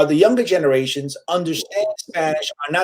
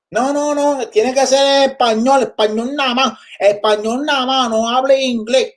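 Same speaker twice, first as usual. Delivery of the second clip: higher, insistent producer voice, a mocking edge; mock network producer, higher; imitating a TV producer; 6 semitones higher than usual. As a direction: parrot the boss who demands Spanish only, pitched up. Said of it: "that's how people have talked to me".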